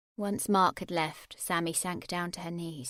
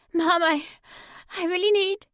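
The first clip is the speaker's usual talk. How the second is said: high, louder, voice close to breaking; near tears; 10 semitones higher, 10 dB louder than usual. A narrator's reading. Sammy begging her mum, close to crying. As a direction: plead through held-back tears.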